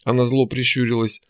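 plain reading voice

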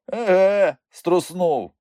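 high, loud echo of his word; amused scoffing. A narrator's reading. Uncle laughing at Ivan's excuse, amused scoffing. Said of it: "Вуйко Микульця засміявся".

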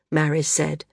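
ordinary delivery

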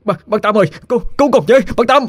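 fleeing son yells to neighbor Tám for rescue; yelling for help. Acted. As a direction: scream for the neighbor to save you. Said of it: "mà la lên".

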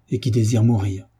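ordinary delivery

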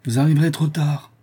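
messenger's high, loud warning; urgent warning. A narrator's reading. Messenger warns they are too late.

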